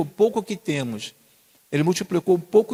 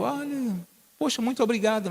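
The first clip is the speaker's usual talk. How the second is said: grateful nurse; 8 semitones higher than usual. Retold or imitated. Nurse thanking, voiced high.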